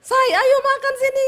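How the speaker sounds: very high, loud hollering; loud summons. Retold or imitated. Wife calling her husband to eat the next day, high shrill holler. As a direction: shriek the dinner call without a breath of softness. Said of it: "Besok begitu lagi".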